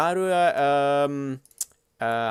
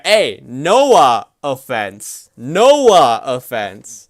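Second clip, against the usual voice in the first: very slow, loud Noah offense; slow drawn pun; 7 dB louder, much slower than usual. Pretend. Streamer drawing out a pun for chat. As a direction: stretch Noah offense out slowly.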